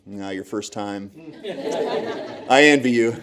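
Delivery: envy the new guy, lightly; mock envious. Bouncers teasing Todd at his first seminar, slow wistful tease.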